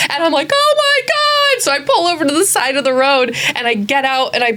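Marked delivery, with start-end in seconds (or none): 0.5-1.6 a high, squeaky burst of panic; panicked. Retold or imitated. Scene: driving in the rain with a bloody pelican loose, high panicked squeal. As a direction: shriek it as the bird suddenly flaps everywhere. Bleeding pelican flapping in car.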